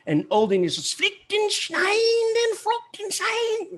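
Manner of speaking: high, slow mock-Germanic gibberish; mock Old English. Shown as a demonstration. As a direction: ham up guttural Old English sounds. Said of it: "Which we just heard a little bit of".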